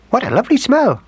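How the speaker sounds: very high mouse voice; mother mouse cry. A narrator's reading. Mother mouse smelling gingerbread, high delighted cry. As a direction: squeak it out in delight. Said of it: "cried the mother mouse".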